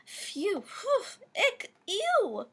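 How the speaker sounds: high, squealing disgusted cries; an exaggerated yuck for the kids. A narrator's reading. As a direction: recoil at something gross. Disgusted animal noises refrain.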